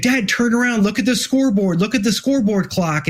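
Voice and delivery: a tearful son urging his dad to look; urgent excited son. Retold or imitated. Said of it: "it's like".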